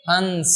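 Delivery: हंस with a long nasal "n" before the s; drawn-out nasal. Shown as a demonstration. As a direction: stretch the nasal in हंस: "hanns". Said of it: "इसको क्या बोलेंगे?".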